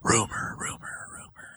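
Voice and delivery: whispered gossip, fading almost to nothing; mock whispering. Host joins in the board member gossip, mock whispering. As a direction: murmur it like a spreading rumor, dying away. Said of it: "there have been whispers".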